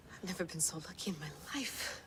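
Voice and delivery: awed whisper. Acted. Awed aside after the find.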